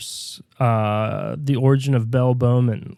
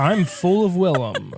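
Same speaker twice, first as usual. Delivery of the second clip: slow, higher mock actor voice; Willem Dafoe; 6 semitones higher than usual. Comic playing the actor mid-mishap, slow hammed-up cry. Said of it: "and he'd go".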